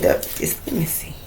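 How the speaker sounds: breathy whisper to herself; whispered aside. Real, not acted. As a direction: drop to a whisper while checking. Muttering while checking cards.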